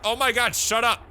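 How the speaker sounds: playful frustrated outburst; exasperated interruption. Acted. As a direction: beg them to stop, fed up. Interrupting mouth-watering food description.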